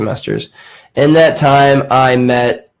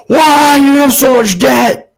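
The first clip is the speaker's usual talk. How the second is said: much higher, louder alarmed cry; parents' alarmed reaction; 14 semitones higher, 4 dB louder, slower than usual. Retold or imitated. Parents hearing their son drop out again, loud shocked outcry. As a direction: throw it back like a shocked parent.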